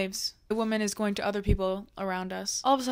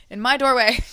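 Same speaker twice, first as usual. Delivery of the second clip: louder, laughing, leaning on my; laughing emphasis; 3 semitones higher, 11 dB louder than usual. Retold or imitated. Telling a listener the man lay in her doorway, laughing and louder. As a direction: laugh it out, disbelief on the word my. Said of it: "right in the doorway".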